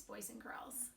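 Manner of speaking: soft whispered aside. Real, not acted. Teacher whispering to her class, softly.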